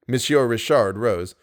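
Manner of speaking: ordinary delivery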